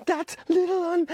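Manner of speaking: breathless high gasp. Acted. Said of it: "gasped Piggy".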